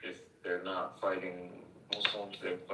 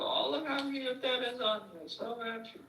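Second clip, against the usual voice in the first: much higher, gushing mock girl voice; gushing girly voice. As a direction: squeal it like a gushing girlfriend. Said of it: "all the other women will go".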